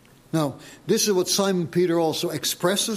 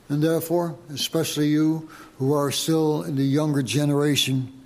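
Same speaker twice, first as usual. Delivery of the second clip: ordinary talk, nothing marked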